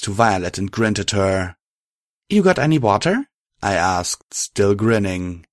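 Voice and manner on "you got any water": in-character question. Acted. Narrator character asks friend.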